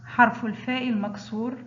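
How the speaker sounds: usual speaking voice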